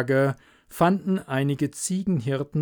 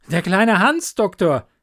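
loud and much higher; calling up; 6 semitones higher, 6 dB louder than usual. Acted. Hans calling up to the doctor at the window, loud. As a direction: shout up against the storm.

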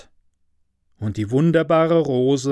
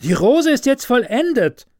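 high exclaiming bush voice; calling bush; 10 semitones higher, 5 dB louder than usual. A narrator's reading. Rose bush announcing the finished rose, high exclamation. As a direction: call out in wonder. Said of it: "rief der Strauch".

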